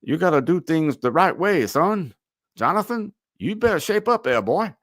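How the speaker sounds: put-on a blustery old Southern board member scolding in a thick Southern accent; Southern drawl. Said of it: "heavy Southern accent".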